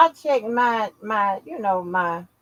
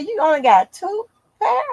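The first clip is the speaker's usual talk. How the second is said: incredulous question; 8 semitones higher, 4 dB louder than usual. Retold or imitated. Friend questioning in disbelief.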